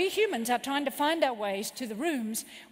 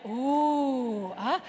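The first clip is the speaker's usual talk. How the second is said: long, rounded ooh, then a short ah; awed ooh and ah; 3 dB louder than usual. Shown as a demonstration. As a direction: show sincere awe with a drawn-out ooh. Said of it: "look at them and go".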